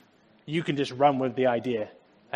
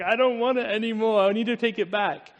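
put-on higher, pleading child voice, a touch of tears; upset child; 6 semitones higher than usual. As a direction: play the six-year-old begging to return it. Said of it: "I start bursting out crying".

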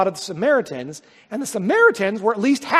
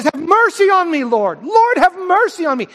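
pleading, much higher and louder; crying out; 8 semitones higher, 6 dB louder than usual. Retold or imitated. Canaanite mother begging Jesus to heal her child, crying out. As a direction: call out desperately for mercy. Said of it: "she follows him crying this out".

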